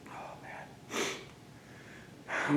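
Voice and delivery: breathy whisper, overwhelmed; emotional whisper. Real, not acted. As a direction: whisper it, choked up. Overcome with emotion.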